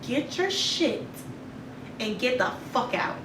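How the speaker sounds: ice-cold calm, slow and soft; calm and quiet. Retold or imitated. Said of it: "I calmly said".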